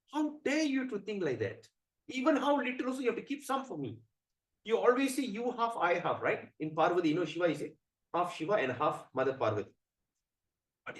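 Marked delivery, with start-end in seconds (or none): 0.1-6.2 high-pitched, affronted scolding; angry wife. Acted. Parvati scolding Shiva for keeping none, angry. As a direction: reproach him as a slighted wife. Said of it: "she gets very angry".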